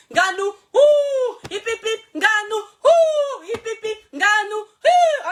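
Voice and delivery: high, loud, stretched-out cheer; full-throated stadium cheer, drawn out slowly; cheering shout. Real, not acted. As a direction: roar Nganou's name like a fan. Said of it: "je fais l'effort de crier".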